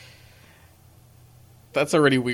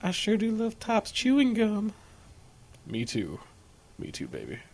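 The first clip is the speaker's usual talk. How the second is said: mock commercial voice; 8 semitones higher, 6 dB quieter than usual.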